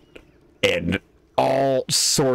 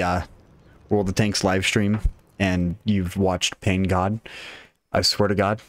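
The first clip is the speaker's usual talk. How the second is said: usual speaking voice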